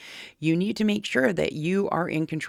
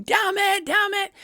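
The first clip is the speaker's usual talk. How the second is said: much higher, louder mock curse; mock angry yelling; 3 dB louder than usual. Imitating the video's owner cursing at the dogs, mock angry yell. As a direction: ham up a panicked owner swearing. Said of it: "So that guy going".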